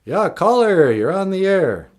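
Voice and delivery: loud, high DJ greeting; radio host voice. Pretend. Radio host answering caller.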